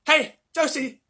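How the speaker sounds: high, loud frantic shout; husband shouting at shrinking wife. A narrator's reading. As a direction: shout for help in panic. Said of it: "shouted Grandpa Joe".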